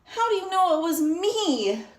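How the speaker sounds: lightly voiced turkey complaining; groaning turkey. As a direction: mildly groan it out. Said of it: "groaned Turkey".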